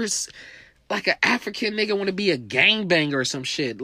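usual speaking voice

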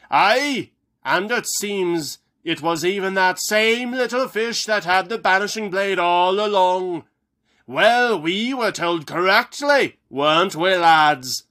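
high, loud, hearty dwarf voice; dwarf general calling out to his troops; stout dwarf. Acted. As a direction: boom it across the hall like a gruff dwarf. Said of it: "a stout voice called".

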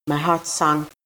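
ordinary delivery, nothing marked

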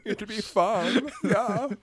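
high-pitched Swedish cartoon voice, half laughing; very high, silly Swedish lilt; mock Swedish accent. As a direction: ham it up like a giddy Swedish puppet. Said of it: "Swedish chef from the Muppets".

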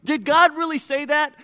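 hammed-up inner doubter mocking God's call. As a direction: taunt like the serpent whispering doubt. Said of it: "like Adam and Eve in the garden".

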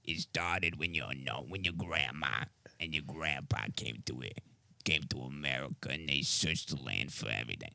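low, hushed old-gangster impression; Tom Hardy's Al Capone. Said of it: "imitate Tom Hardy's Al Capone voice".

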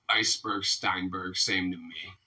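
low, soft throwaway punchline; deadpan dismissal. Acted. Chinese guy in a joke gives his payback excuse, deadpan. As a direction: drop low and quiet, shrugging it off.

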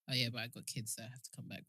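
low, trailing-off afterthought; muted aside. Real, not acted. Remembering the kids mid-fantasy, low muted aside. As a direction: drop right down, almost to yourself.

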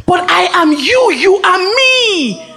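preaching at full force. Acted. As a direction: shout the revelation like a prophet.

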